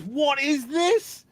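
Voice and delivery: high, loud, drawn-out amazement; slow, blaring, astonished question; retold excited shout. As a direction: imitate a thrilled friend demanding to know. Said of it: "so excited".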